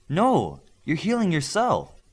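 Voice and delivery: she's stunned by what she finds; a touch of wonder; amazement. A narrator's reading. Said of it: "Bioforce said with amazement".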